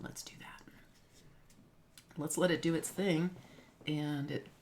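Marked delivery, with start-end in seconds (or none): 0.0-0.6 whisper. Real, not acted. Whispered aside.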